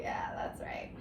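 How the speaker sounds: playful whispered aside; teasing whisper to the class. Real, not acted. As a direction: whisper it like a little secret.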